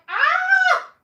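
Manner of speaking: high piercing shriek of feeling; emotional shriek. Real, not acted. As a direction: let out a sudden overwhelmed scream. Reacting to emotional scene.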